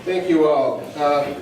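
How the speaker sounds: usual speaking voice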